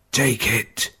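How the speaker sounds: faint but voiced, insisting with the word Take; weak voiced plea. A narrator's reading. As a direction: press the word Take weakly. Dying father insists on ring.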